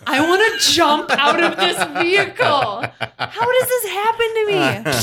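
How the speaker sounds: half-laughing, throw up your hands in mock horror; laughing mock despair. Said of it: "And I was like".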